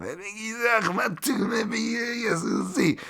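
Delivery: high, strained mock German accent; a terrible put-on German accent. Host mocking a German-sounding fighter's name in accent. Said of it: "terrible, inappropriate German accent".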